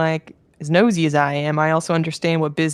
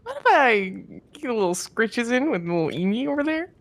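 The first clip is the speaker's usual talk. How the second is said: high, bright friendly pleading; cheerful Aegon; 7 semitones higher, 4 dB louder than usual. Acted. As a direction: sweet-talk your way to the pet.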